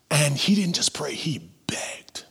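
grave, near-whispered emphasis; hushed. Real, not acted. Preacher telling the congregation how Paul begged, hushed. Dramatic hush on begging.

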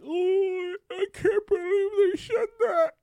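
very high, put-on scandalized voice; mock a pearl-clutcher in a squeaky voice; mock offended. People today shocked by lobby talk, high mock outrage.

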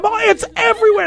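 high and fast, flustered; rushed panic. Retold or imitated. Teller recalls the goop going everywhere, flustered and fast. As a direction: gabble it out in a panic.

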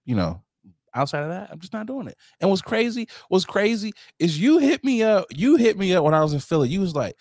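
ordinary talk

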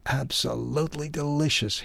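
high, howled delight over the corn; howling. A narrator's reading. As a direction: Ralph howls in delight at the tasty corn, high and strained. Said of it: "he howled".

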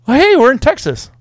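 high, slow, cheery announcement; mock road-trip cheer. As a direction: sing it out like an upbeat tourist. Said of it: "but you're like".

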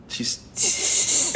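usual speaking voice

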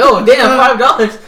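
loud, high retold outburst; mock shock. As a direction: exclaim in exaggerated disbelief at a lowball offer. Retelling reaction to lowball offer.

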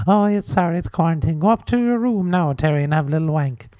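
high, lilting Irish mammy; Aunt Terry. Pretend. Mother soothing son Terrence, high lilting auntie voice. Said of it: "that's, um, that's Anne Terry's son".

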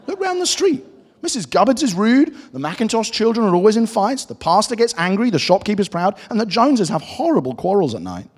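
high-pitched, lively storybook cat; talking cat. Acted. Cat lists neighbours' faults in story.

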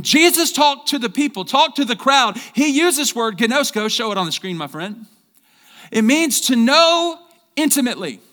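loud, high preaching at full force; fired-up preaching. Acted. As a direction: preach it out to the whole church. Pastor preaching to congregation.

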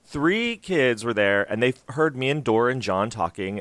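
usual speaking voice

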